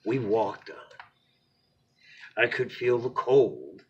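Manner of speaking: lively storybook narration; expressive read-aloud to a little one; bedtime story reading. A narrator's reading.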